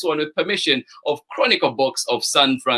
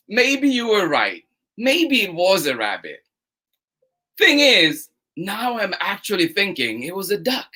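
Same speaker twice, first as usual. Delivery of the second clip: lively, bouncy storytime read with two bickering voices; reading to children; 2 semitones higher than usual. Acted. Storytime host reading to children, animated and playful. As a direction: play both sides of the argument for the kids. Reads picture book aloud to kids.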